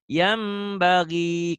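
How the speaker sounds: usual speaking voice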